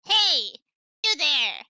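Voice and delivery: loud guard shout. Acted. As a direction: yell it like a guard. Guard character shouting at them.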